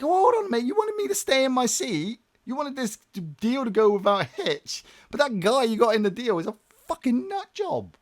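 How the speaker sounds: high, loud exasperated complaint; outraged, voice jumping way up; indignant rant. Retold or imitated. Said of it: "you're like".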